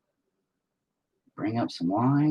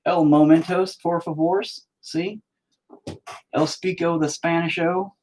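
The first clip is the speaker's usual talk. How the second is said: jokey fake Spanish accent, a little higher; mock Spanish; 7 semitones higher than usual. Showing off his fake Spanish while painting, in a mock Spanish accent. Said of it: "I can speak the Spanish".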